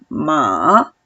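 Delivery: MA dipping low, then rising; third tone. Shown as a demonstration. Teacher contrasting tones for student, MA low dipping. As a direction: drop MA into a well. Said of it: "como si te cayeras a un pozo".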